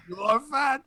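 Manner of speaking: high mock-French verdict; French accent. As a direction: pronounce judgment in a fake French accent. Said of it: "in French, you could just do that".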